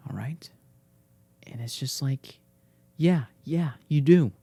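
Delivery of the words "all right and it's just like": whisper. Real, not acted. Whispering to the dog.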